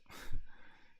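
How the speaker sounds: short voiceless laugh. Real, not acted. Player amused by the story, short laugh. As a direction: burst into a breath of laughter. Amused by comment just read.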